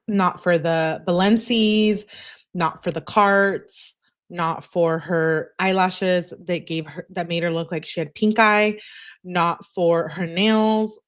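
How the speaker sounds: each item stretched, Balencis and carts spelled out slowly; slow emphatic list. Real, not acted. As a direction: stress Balencis, carts, eyelashes, nails slowly. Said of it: "listing slowly".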